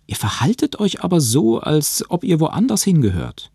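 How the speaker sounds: höher, Betonung auf euch; nachdrücklicher Vorwurf. Acted. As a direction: bohrend wiederholt. Josh wiederholt seinen Vorwurf nachdrücklicher. (Josh beharrt gegenüber dem Professor, nachdrücklich)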